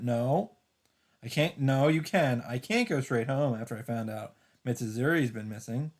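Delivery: ordinary delivery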